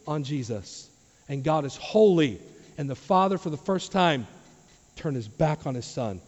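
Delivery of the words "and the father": normal talking voice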